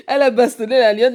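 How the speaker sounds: amused and loud; laughing. Real, not acted. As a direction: chuckle through the rumour. Host laughing at fight rumour.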